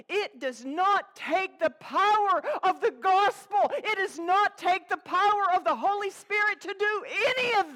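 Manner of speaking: full-force revival preaching; fiery preaching. Acted. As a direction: pound it home from the pulpit.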